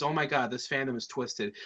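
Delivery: ordinary delivery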